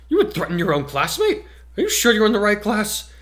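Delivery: aghast at a classmate's threat; shocked gasp. Acted. Class rep shocked by a threatening classmate, rising high and loud. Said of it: "recoiled a half pace and gasped".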